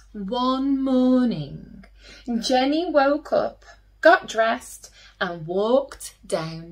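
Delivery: slow, gentle read-aloud storytelling; storytelling to children. A narrator's reading. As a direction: tell it like a bedtime story for little ones.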